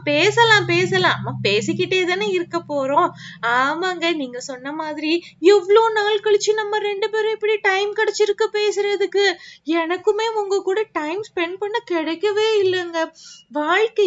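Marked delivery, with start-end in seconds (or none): none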